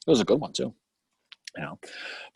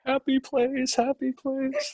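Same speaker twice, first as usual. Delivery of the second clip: high silly voice; much slower than usual. Acted. Calming himself jokingly.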